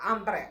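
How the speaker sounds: hambre with the H left silent. Shown as a demonstration. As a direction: start hambre straight on the A vowel. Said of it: "pronounce ചെയ്യത്തില്ല".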